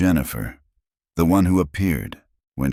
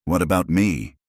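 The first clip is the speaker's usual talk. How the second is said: plain reading voice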